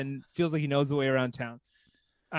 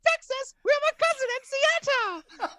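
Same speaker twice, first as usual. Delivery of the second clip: put-on very high-pitched London accent; London sister impression; 3 dB louder than usual. Sister meeting a Texan, high London accent. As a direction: echo the high mock London girl accent. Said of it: "And the sister goes".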